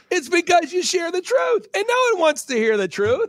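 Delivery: high-pitched imitation of an excited friend; hammed-up, high-pitched retold exclamation; excited revelation. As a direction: blurt out the big answer, voice way up. Acted quote of friend's big revelation.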